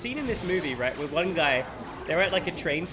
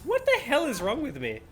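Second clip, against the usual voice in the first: normal talking voice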